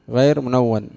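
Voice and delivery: غير منون said loud and firm; teacher hammers غير منون home; stressed emphasis. Shown as a demonstration. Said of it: "متحرك غير منون".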